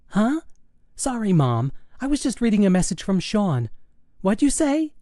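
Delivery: put-on high girlish voice, caught off guard; high-pitched, absent-minded daughter; distracted teenage daughter. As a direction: play a daughter who wasn't listening. Daughter's voice in story.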